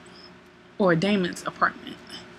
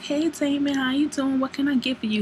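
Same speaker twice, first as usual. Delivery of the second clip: higher-pitched imitation of a bartender lady; friendly bartender; 7 semitones higher than usual. Bartender lady greeting Damon at the club, friendly. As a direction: pitch it up, greeting a regular customer.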